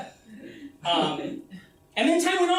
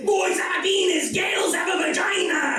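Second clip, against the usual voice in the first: Arnold impression; 10 semitones higher than usual. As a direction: yell the line like a hammed-up action hero with an Austrian accent. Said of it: "say it like Arnold Schwarzenegger".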